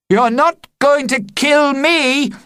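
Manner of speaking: high, loud defiant shout; boy shouting defiance at his dragon, loud shout. Acted. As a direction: yell it at the dragon. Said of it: "I shouted at him".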